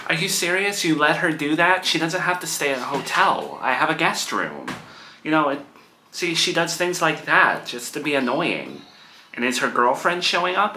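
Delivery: can't believe what she's hearing; exasperated. Real, not acted. Daughter reacts to mother's news.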